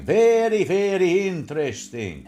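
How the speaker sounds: loud, drawn-out "very"; comic catchphrase. Acted. Host repeats a pet phrase, comic catchphrase voice.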